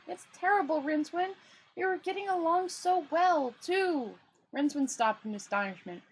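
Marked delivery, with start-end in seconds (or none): 0.1-4.2 lightly voiced naive tourist, high; a hint of a cheerful, puzzled traveller. Two Flower lamenting to Rincewind.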